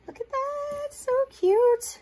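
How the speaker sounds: high squeal over something cute; delighted squeal. Real, not acted.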